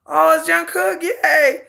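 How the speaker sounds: high, forced mock cheer with a sob; sarcastic yay. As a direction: fake happiness, half crying. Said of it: "And I'm like".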